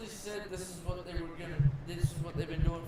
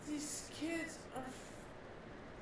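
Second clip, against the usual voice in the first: breathy disbelief; 7 semitones higher, 10 dB quieter, much slower than usual. Real, not acted. Exasperated outburst about kids.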